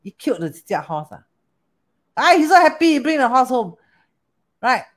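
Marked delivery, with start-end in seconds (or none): 2.2-3.8 overjoyed. Retold or imitated. Villager overjoyed at horse.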